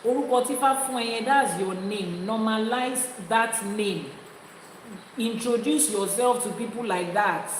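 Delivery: usual speaking voice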